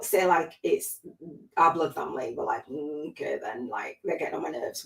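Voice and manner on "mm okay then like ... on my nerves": reluctant, barely audible concession; grudging mutter. Acted. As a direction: mumble it half-heartedly, under your breath. Voices a reluctantly grateful person.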